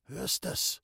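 soft, faint whispered question. A narrator's reading. Sick man asking Pauli about the music, whispered. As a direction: ask under your breath, feebly.

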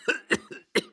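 put-on old man's dry cough. A narrator's reading. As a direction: cough weakly. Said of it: "und er hustete". (trockenes Husten)

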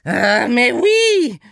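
hoch, laut und langsam gejammert, französischer Akzent; jammernd. A narrator's reading. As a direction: klagend ausseufzen wie ein verzweifelter Franzose. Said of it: "jammerte Saint-Saëns". (jammernd, französischer Akzent)

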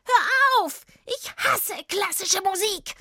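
very high, drawn-out shriek; loud wail easing into a high screech; screaming witch. A narrator's reading. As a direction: shriek in horror like a tormented witch. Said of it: "schrie sie".

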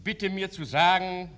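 normal talking voice

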